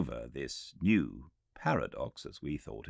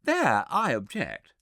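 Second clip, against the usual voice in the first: high, louder protest; objecting Philby; 12 semitones higher, 6 dB louder than usual. Acted. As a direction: object sharply, pitched up. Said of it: "said Philby".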